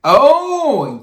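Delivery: high, loud gasp of recognition; sudden realization. Real, not acted. Co-host realizing who the woman is, surprised. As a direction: it suddenly clicks.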